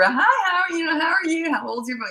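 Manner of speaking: high, chirpy hello to a stranger; chirpy park-mom greeting; bright mock greeting. Friendly greeting of other mom.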